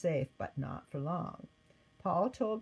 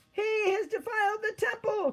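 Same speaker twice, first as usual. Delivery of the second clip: much higher, louder outraged shout; angry cry; 5 dB louder than usual. Acted. Temple worshipper accusing Paul, outraged shout.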